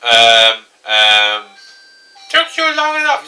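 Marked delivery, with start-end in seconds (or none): 2.3-3.3 high, impatient sister; sister character voice. Acted. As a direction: chide him in a pitched-up voice. Sister character greets him.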